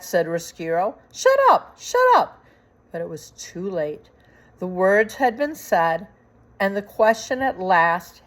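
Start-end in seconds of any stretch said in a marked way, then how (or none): 1.1-2.4 very high, loud squeaking panic; a rat losing control; shrieking rat. Acted.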